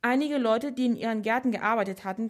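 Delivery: ordinary delivery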